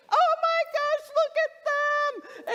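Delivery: very high, loud squeal, stretched at the end; excited shrieking. Retold or imitated. Cat owner showing husband the kittens, excited shrieking. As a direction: shout it with uncontained delight. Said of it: "I was so excited".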